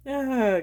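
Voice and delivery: tearful laugh ending in a high "ah"; real laugh of emotional release. Real, not acted. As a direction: laugh off the tears, then let out a sigh. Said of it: "gotta laugh it out".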